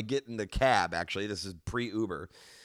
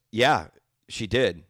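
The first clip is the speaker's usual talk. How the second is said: blunt; 7 dB louder, slower than usual. Retold or imitated. Old man answers.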